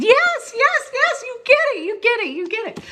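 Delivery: very high, loud, rapid-fire excitement; elated agreement. Retold or imitated. Speaker thrilled the scientist understands, excited. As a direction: cheer the friend on, building fast. Retells delighted agreement with friend.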